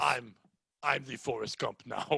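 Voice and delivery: put-on low, soft Jamaican accent. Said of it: "like, a Jamaican voice".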